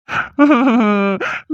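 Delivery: a short, strained, odd laugh. Acted. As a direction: laugh out in disbelief. Groom breaks down after wedding.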